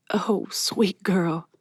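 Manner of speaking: slow, breathy whisper near tears; tearful whisper. Acted. Miss Patty moved to tears by Mallory, whispering. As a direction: let it catch in the throat.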